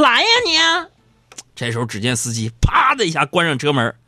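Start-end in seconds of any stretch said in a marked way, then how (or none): 0.0-0.6 shouting. Retold or imitated. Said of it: "嚷嚷说".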